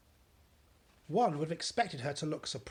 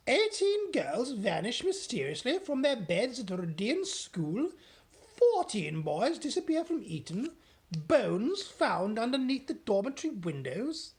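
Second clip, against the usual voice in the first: higher, louder, alarmed reading; Queen reading aloud; 8 semitones higher, 6 dB louder than usual. Acted. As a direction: read the news in mounting horror. Queen reads headlines aloud.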